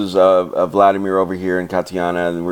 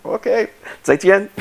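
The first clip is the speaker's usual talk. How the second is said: laughing goodbye; 14 semitones higher than usual. Retold or imitated. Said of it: "which is goodbye in Chinese".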